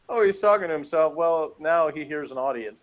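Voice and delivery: normal talking voice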